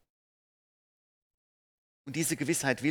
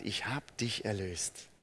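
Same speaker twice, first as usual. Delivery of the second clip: slow, softer, lower tender promise; gentle reassurance; 4 semitones lower, 4 dB quieter than usual. Acted. God calling a believer by the new name, slow and tender. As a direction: say it like a calm embrace. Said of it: "er ruft uns einfach bei dem neuen Namen und sagt".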